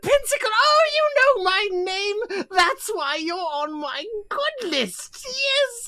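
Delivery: very high, sugary voice; creepy Santa. Acted. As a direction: flatter the child like a sinister Santa. Santa answers the boy.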